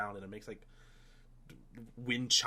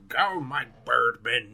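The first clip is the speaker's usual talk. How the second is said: villain command; 7 dB louder, slower than usual. Retold or imitated. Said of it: "I thought they were just calling them".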